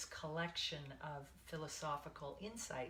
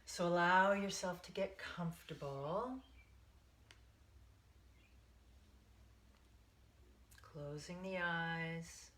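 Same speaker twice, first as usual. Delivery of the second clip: guided meditation. Acted. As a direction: ease them into the breath, one count at a time.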